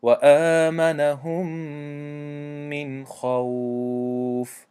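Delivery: خوف drawn out slowly with a heavy خا; Quran recitation with full kha. Shown as a demonstration. Teacher reciting a verse to show the heavy kha. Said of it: "دیگر مثال".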